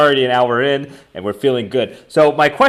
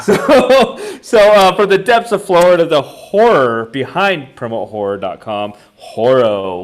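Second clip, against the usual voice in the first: announcer; 3 semitones higher, 6 dB louder than usual. Acted. Introducing guest from Florida.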